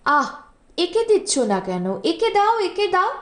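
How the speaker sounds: sharp rebuke to a servant; scolding, pitch jumping up on একে দাও. A narrator's reading. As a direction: snap at the bearer, rising high on the repeat. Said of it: "বেয়ারাকে ডেকে ধমক দিলেন".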